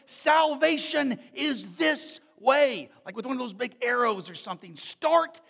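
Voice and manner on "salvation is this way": very slow, loud, higher proclamation; loud and clear. Pretend. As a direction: announce it from the clouds. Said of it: "in a very loud and clear voice".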